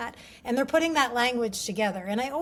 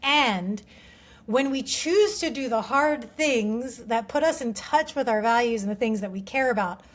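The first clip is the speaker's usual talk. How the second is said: ordinary talk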